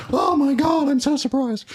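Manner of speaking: high gushing mock surprise; fake surprise. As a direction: gush like a stunned winner. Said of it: "nobody goes up and goes".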